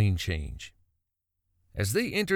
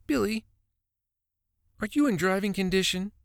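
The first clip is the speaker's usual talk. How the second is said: querying wife; 9 semitones higher than usual. A narrator's reading. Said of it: "Pam queried".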